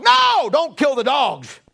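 high, loud, slow shouted protest; mock shout. Preacher shouting at imagined hearer, mock outrage. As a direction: cry out in mock horror.

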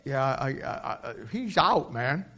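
soft, slow, halting mumble; glum Peter. Acted. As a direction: trail off in shame. Peter dejected stammer.

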